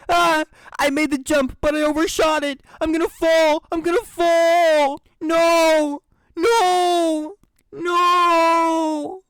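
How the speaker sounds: panicked high screams while falling; screaming while falling. Acted. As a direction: scream in terror as you drop. Overshoots jump and falls.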